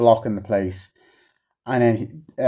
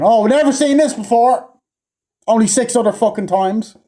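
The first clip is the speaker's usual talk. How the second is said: much higher, louder sarcastic gasp of wonder; mock amazement; 13 semitones higher, 6 dB louder than usual. Reviewer mocking the film's repeated plot, in sarcastic awe. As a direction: fake awe dripping with sarcasm.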